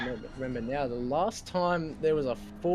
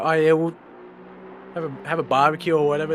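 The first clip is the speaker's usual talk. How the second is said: a casual Aussie mate; broad Aussie voice; 3 semitones higher, 7 dB louder than usual. Retold or imitated. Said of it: "we here in Australia, we go".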